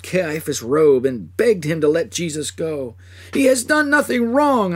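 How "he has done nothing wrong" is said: high, loud pleading cry. Acted. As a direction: beg the high priest at his feet. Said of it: "I cried".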